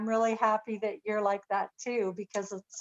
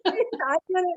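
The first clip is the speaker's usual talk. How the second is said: tearful agreement; 7 semitones higher, 4 dB louder, much slower than usual. Real, not acted. Moved listener, crying while agreeing.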